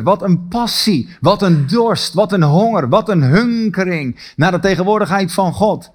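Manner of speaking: usual speaking voice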